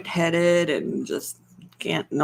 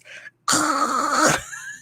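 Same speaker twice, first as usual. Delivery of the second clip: rasped throat purr that sounds like choking; choking failed purr; 8 semitones higher than usual. Shown as a demonstration. Wife copying husband's purr, a choking throat rattle. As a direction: try to purr and choke on it. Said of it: "I sound like I'm choking on something".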